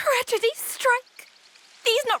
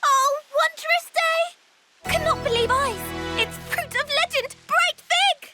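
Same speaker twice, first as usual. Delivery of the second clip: drawn-out cry of delight, then eager rush; overjoyed wonder; 4 semitones higher than usual. Acted. Spotting fruit of legend, overjoyed amazement. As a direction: marvel theatrically, peaking high on the find.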